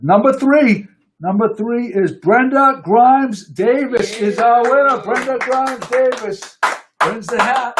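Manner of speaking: high, drawn-out raffle announcement; winner announcement. Real, not acted. Host announcing the hat raffle winner, excited proclamation.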